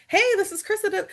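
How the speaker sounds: bright, high bubbly hello, pitched well up; bubbly upbeat cold-call greeting. Retold or imitated. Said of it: "I'm very bubbly".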